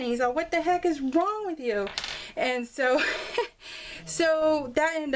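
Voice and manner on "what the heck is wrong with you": higher-pitched mock exasperation; retold scolding. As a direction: act out a dad's telling-off, without much volume. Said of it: "just a reaming".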